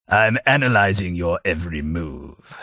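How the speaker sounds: normal talking voice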